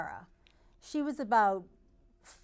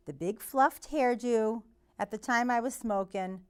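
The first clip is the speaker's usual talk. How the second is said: ordinary talk, nothing marked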